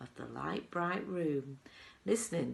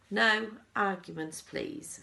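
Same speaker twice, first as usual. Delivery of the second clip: firm; 5 semitones higher, 6 dB louder, slower than usual. Acted. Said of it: "said Mister Wonka".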